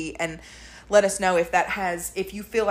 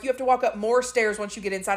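ordinary delivery

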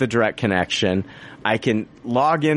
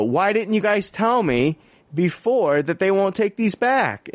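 indignant question; 7 semitones higher than usual. Retold or imitated. Angry question to support.

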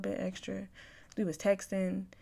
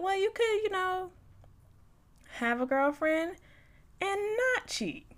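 high, much higher sing-song retort; sassy retort; 12 semitones higher than usual. Retold or imitated. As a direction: lecture him sweetly from way up high. Retelling her sassy reply.

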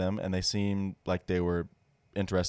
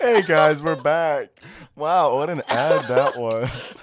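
excited host; 6 semitones higher, 10 dB louder, slower than usual. Acted. Hosts coming back from ad.

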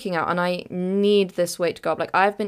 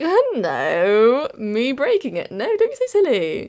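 high, overdone innocent denial; nervous fake breeziness; 5 semitones higher, 4 dB louder, slower than usual. Daughter denying to dad she broke it, fake breezy. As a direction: brush it off far too brightly, pitch soaring. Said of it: "I just start laughing".

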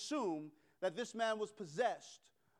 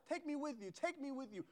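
put-on a touch of a child pleading, softer and higher; childlike crying plea; 8 semitones higher, 3 dB quieter than usual. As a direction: plead like a little child close to tears. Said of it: "Like a little child ... crying".

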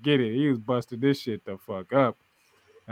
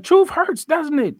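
taunting; 6 dB louder, slower than usual. Retold or imitated. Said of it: "he's like".

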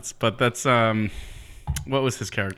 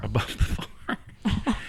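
whispered; 3 dB quieter, much slower than usual. Pretend. Mocking repeat of the phrase.

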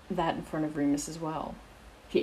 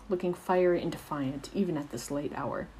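plain reading voice

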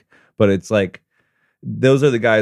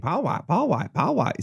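put-on Southern accent; 9 semitones higher than usual. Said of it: "Why are you throwing a Southern accent on it?".

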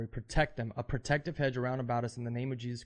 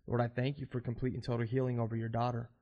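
ordinary delivery

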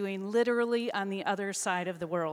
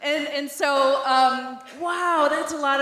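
excited; 5 semitones higher, 7 dB louder, slower than usual. Acted. Repeating the word means wow.

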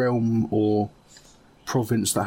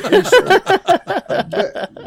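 laughing; 9 dB louder than usual. Real, not acted. Bursts out laughing mid-sentence.

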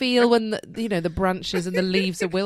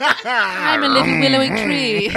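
mock dreamy tree voice; 5 semitones higher, 8 dB louder, slower than usual. Mocking her own tree analogy.

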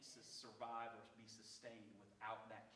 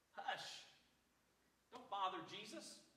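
hushing; 6 semitones higher than usual. Acted. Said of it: "tried to get him to be quiet".

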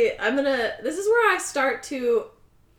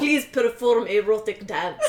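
put-on Russian accent; 2 semitones lower than usual. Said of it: "I sound like Gru from Despicable Me".

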